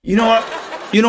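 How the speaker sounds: high, loud laughing talk; crying with laughter at the joke; laughing so hard he's crying. Real, not acted. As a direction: gasp it out through real laughter. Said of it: "I'm crying".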